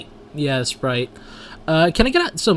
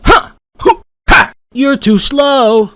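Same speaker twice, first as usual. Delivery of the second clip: taunt; 10 semitones higher, 5 dB louder, slower than usual. Acted. Taunting enemy in game.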